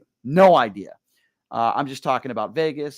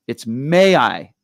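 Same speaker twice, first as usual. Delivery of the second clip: Bill Murray correcting; 2 semitones higher, 10 dB louder, slower than usual. Retold or imitated. Bill Murray teasingly corrects the fan's grammar, slow mock correction. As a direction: drag it out like a pedantic teacher. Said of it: "He was correcting your grammar".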